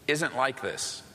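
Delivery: usual speaking voice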